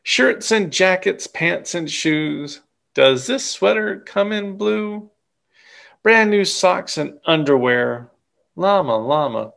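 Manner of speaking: usual speaking voice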